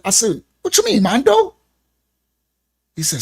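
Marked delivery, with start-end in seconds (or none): 0.6-1.5 high, breezy feigned innocence; playing innocent. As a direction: brush it off like nothing's wrong. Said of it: "I'm playing it".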